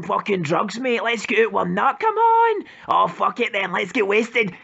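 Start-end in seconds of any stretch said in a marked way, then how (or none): 2.0-2.6 excited urging. Acted. Urging mates to get wasted.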